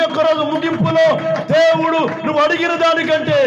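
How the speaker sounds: high, loud and driving, peaking in a shouted praise; full-force preaching. Real, not acted. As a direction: thunder the promise at the crowd.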